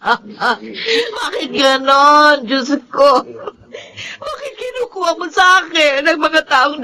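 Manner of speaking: sobbing, voice breaking on each name; crying grief. Acted. Mother mourning her son to God, sobbing. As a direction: wail the loss to God through tears. Mother grieves son's death.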